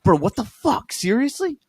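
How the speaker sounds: hammed-up disbelief at the roommate; mock outraged. Reading angry text to roommate.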